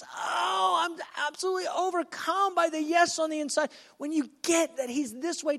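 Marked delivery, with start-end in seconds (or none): 0.0-3.7 high, swept-away outpouring; acted overwhelmed love. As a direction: let it soar high, overcome with feeling. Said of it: "but he goes".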